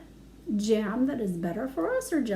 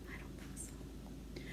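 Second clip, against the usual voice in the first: whisper; 22 dB quieter, slower than usual. Acted.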